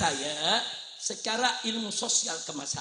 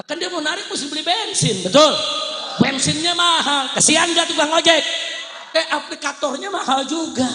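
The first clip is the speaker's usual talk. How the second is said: high, raised pulpit voice; loud, forceful protest; impassioned preaching; 6 semitones higher, 8 dB louder than usual. Real, not acted. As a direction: plead the drivers' case to the crowd. Impassioned plea for drivers.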